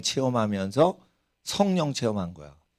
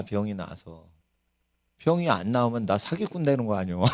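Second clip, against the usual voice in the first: ordinary talk, nothing marked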